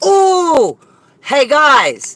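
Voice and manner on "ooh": sudden high yelp; startled high ooh. Real, not acted. Reacting to a surprise, high yelp. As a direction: yelp as if caught off guard.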